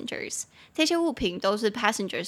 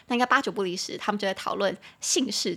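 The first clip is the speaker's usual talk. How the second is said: usual speaking voice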